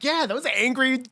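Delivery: high-pitched, emphatic mock agreement; imitating exasperated fellow islanders; mock agreeing islanders. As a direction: chime in high, as if fed up with them. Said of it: "people from the other islands is like".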